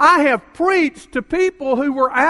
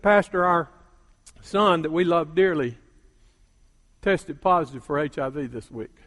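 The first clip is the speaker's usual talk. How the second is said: soft, lower, heavy; grave sorrow; 7 semitones lower, 6 dB quieter than usual. Retold or imitated. Parents telling their pastor about their son, quiet grief. Parents reveal son's HIV.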